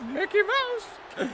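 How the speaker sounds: very high falsetto, drawn out; Mickey Mouse. Acted. Streamer doing requested impersonation, cartoon mouse falsetto. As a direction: sign off slowly as a squeaky cartoon mouse. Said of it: "Can you do Mickey Mouse?".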